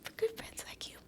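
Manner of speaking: for good friends like you whispered, breathless; whispered thanks. Real, not acted. Thanking her loyal friend in a tearful whisper. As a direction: barely breathe it to your friend.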